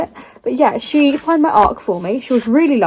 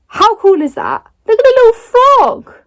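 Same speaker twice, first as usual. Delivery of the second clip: high, bright squeal of delight over the frog; excited squeal; 11 semitones higher, 6 dB louder than usual. Real, not acted. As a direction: gush like you just spotted a treasure. Showing off the frog edges.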